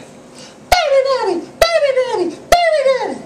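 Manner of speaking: little daughter shouting across the store; toddler screaming. Retold or imitated. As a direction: squeal it like a three-year-old in a store. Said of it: "started screaming".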